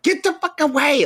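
high mock snap; mock angry girls. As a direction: girls furious at the boys. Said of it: "the girls would be so mad".

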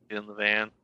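low, soft creepy lure; sweet creepy coaxing. Retold or imitated. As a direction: quietly coax the kid into the van. Punchline of creepy joke.

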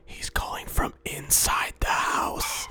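loud, slow, drawn-out panic; hammed-up horror-movie shriek of alarm; mock horror reveal. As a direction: cry it out in staged terror. Mock horror-film reveal about the caller.

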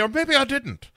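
very high comic Irish lilt; hammed-up Irish accent. [name] being cagey about an old fling, mock Irish accent. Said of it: "inherited this Irish accent from".

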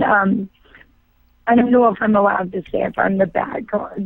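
ordinary talk

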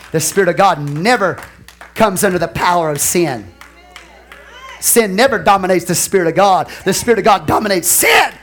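loud preacher declaring, building to a shout; emphatic pulpit declaration; forceful preaching. Real, not acted.

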